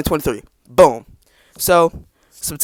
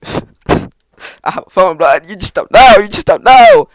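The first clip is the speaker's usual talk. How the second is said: teasing inner gloat; 3 semitones higher, 10 dB louder, slower than usual. Retold or imitated. Gloating to himself as his dad offers a phone. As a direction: gloat like you're in on a secret.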